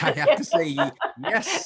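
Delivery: breaking into high, loud laughter; laughing. Real, not acted. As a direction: laugh through the words. Laughing at host's setup.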